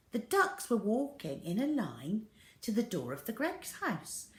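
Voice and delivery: ordinary delivery, nothing marked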